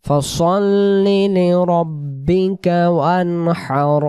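slow chanted recitation; drawn-out Quranic chant. Shown as a demonstration. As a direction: recite slowly and melodically. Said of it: "তারপরে শা".

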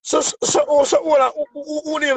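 high, urgent questioning; excited high demand. Real, not acted. Pushing a friend on a call, high and urgent. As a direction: press them hard for the name.

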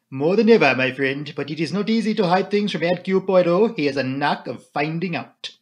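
emphatic, self-assured Belgian detective; Poirot. A narrator's reading. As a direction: declare it with a French accent, opening loud. Narrator plays Poirot.

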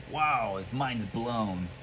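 usual speaking voice

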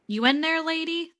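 high, loud check on a dozing listener; calling to rouse someone. Acted. Rousing someone who dozed off.

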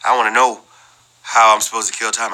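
normal talking voice

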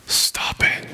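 whispered command. Acted. Preacher telling students to stop beating themselves up, whispered. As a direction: whisper it firmly to the crowd. Preacher urging self-acceptance.

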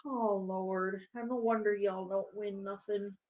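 low, soft, weary aside; resigned grumble. Real, not acted. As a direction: give up on the team after a sigh. Gives up on losing team.

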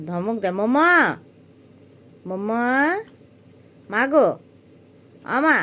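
loud rising call to the cat; loud call to an angry cat. Real, not acted. Calling the angry mother cat.

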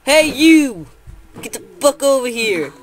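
loud taunting call. Acted. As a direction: shout across to the mob. Calling out to mobs.

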